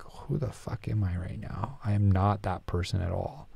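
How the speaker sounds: hushed self-reproach. Retold or imitated. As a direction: quietly ask yourself who you've become. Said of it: "And I was just like".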